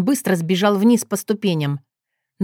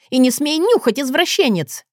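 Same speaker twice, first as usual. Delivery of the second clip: higher-pitched scandalized outcry; indignant cry; 7 semitones higher than usual. A narrator's reading. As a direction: cry out in outrage. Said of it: "возопила уже я". (возмущённый выкрик)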